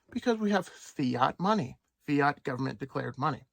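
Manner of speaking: ordinary delivery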